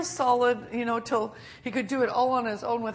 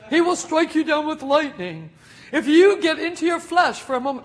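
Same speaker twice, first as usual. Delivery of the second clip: mocking threat of doom; 4 semitones higher, 8 dB louder than usual. Mocking legalistic warning.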